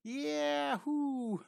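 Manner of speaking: playful high-pitched yahoo; gleeful whoop. Acted. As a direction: whoop lightly for a flying cartoon girl. Whooping for the flying character.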